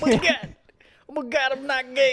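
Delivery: high mock celebrity voice; hammed-up high-pitched star impression; John Travolta. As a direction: protest in a silly high caricature. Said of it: "I'm John Travolta".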